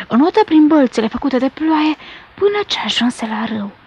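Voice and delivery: usual speaking voice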